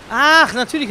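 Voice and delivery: high, loud sudden recognition; light up as it clicks; sudden realization. Real, not acted.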